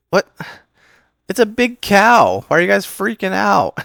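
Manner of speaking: loud, very high incredulous cartoon voice; hammed-up high-pitched hero, puzzled by the fuss; Goku. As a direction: squawk it in baffled disbelief. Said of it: "he's just like".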